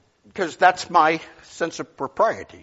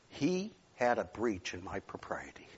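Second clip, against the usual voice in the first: whisper; 8 semitones lower, 15 dB quieter than usual. Real, not acted. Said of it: "unvoiced whisper".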